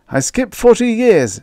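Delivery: high tearful voice, pitched well up; baker in tears. Acted. Baker tearfully skipping ahead in his tale, high voice. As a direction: speak through tears, high and strained. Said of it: "said the baker in tears".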